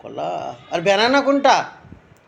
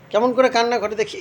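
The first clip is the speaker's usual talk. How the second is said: usual speaking voice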